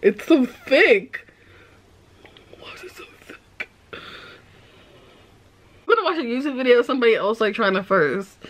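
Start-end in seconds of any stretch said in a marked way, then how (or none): 2.7-4.0 breathy whisper of disbelief; disgusted whisper. Real, not acted. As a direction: whisper it to yourself, put off. Disgusted at the thick eggnog.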